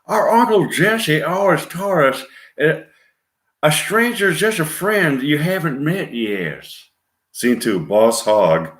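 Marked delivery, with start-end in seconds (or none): 0.0-2.8 put-on thick Southern accent. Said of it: "speaking with thick Southern accent". 3.6-6.7 put-on thick Southern accent. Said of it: "speaking with thick Southern accent".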